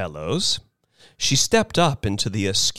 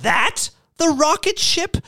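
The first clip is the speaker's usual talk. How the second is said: put-on high-pitched, appalled old lady; appalled cry; 13 semitones higher than usual. A narrator's reading. Missus Bellows seeing the rocket ship, appalled. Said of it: "cried Missus Bellows, appalled".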